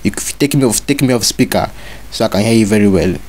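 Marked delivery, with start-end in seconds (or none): none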